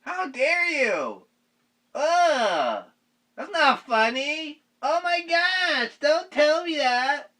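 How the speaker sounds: high, slow scandalized voice; mock horrified listeners. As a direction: ham up prim disgust. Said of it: "They're like".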